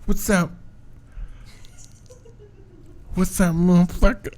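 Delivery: high-pitched trashy dude greeting; coworker impression. Practicing the work guy's greeting, high put-on voice. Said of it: "I know I can do it".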